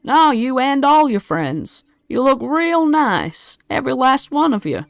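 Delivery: high, loud sweet-talking goblin; butter him up in a high goblin voice; flattering goblin. Acted.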